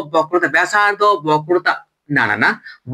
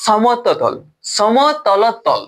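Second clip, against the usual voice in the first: সমতলতল sounded out very slowly syllable by syllable; drawn out; much slower than usual. Shown as a demonstration. Teacher struggling to pronounce the term for students, slow. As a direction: say সম-ত-ল-তল slowly and carefully. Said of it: "উচ্চারণে হচ্ছে না".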